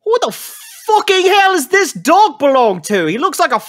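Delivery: high, loud outraged shout; furious Doctor Crackett. Acted. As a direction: explode at the strange dog. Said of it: "Doctor Crackett ... goes".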